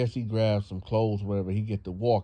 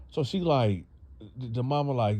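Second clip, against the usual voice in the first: ordinary delivery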